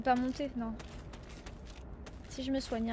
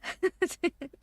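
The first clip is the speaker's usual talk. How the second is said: breathless laugh; 6 semitones higher, 5 dB louder than usual. Real, not acted. Still laughing after the escape.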